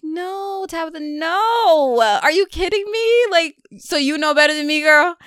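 high, loud, incredulous scolding God voice; sassy God telling her off; God, loud and mock exasperated. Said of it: "And God is like".

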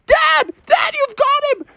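put-on very high, thrilled child voice; excited Froggy. Froggy to dad reeling the whopper, very high excited. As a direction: squeal that dad has it. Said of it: "And Froggy was like".

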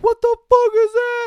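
high, loud outraged yell; acted cry of disbelief; shocked yell. Crowd shocked by aliens in supermarket.

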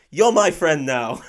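loud, drawn-out imitation of an exclamation. Barber to customer in the chair, imitated loud exclamation. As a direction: exclaim it slowly, at volume. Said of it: "just really loudly exclaimed".

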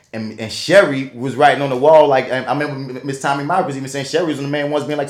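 ordinary delivery

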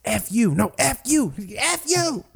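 imitating the cussing, pitch rising each time; mock cussing match. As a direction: ham up a back-and-forth swearing fight, rising. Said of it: "We were just sitting there cussing at each other".

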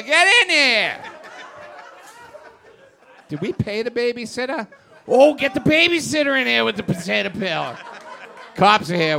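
loud, fast frantic shouting, laughing through it; angry rant. Acted. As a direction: bellow it like an unhinged husband. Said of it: "he sounded really angry and really upset".

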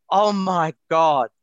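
slow, loud, stunned exclamation; acted-out shock, drawn out and loud. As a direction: let the horror land slowly after a gasp. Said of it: "I'm in shock".

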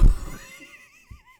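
loud high shrieking laugh; burst of laughter. Real, not acted. Speaker laughing at her own number-ten story, loud high laugh. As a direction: crack up at the punchline.

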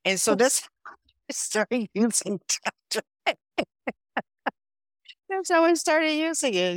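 high, breaking into real laughter; laughing while talking. Real, not acted. Guest ending the nickname story for the host, real laughter through the words. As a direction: laugh your way through the punchline.

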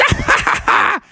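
high, airy villainous laugh; giddy high-pitched gloating laughter; greedy cackle. Acted. Bubby greedily grabbing the coins.

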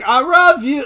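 high, loud caricature voice; Ping. Retold or imitated. Podcaster imitates Michael's goodbye character, high loud caricature. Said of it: "And we get Ping".

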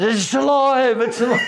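high-pitched hammed-up cry; mock alarm. Comedian telling how he jumped on giant bloomers, mock-alarmed high cry. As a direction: play a comic panic, voice shooting up.

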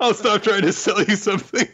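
high, laughing mock quote. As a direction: squeeze the line out through laughter. Laughing joke as the seller.

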